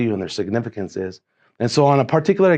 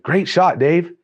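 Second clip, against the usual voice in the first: higher-pitched called-out praise; impulsive cheer; 7 semitones higher, 4 dB louder, slower than usual. Retold or imitated. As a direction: burst out with spontaneous praise.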